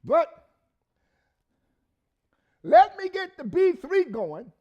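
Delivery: loud high sermon call; preaching at full force. Acted. Preacher calls the band in, loud high preaching. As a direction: rouse the church as the music starts.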